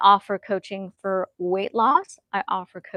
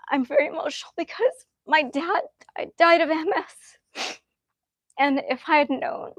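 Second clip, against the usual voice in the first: close to tears; 7 semitones higher than usual. Real, not acted. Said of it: "I'm about to cry".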